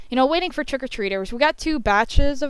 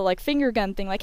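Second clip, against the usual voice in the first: ordinary delivery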